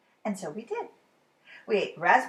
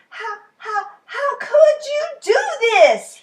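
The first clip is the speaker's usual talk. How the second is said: high, loud, slow crying disbelief; distressed father; 12 semitones higher, 11 dB louder, slower than usual. A narrator's reading. Father wailing at his son over the haircut, crying. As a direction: wail over the ruined haircut.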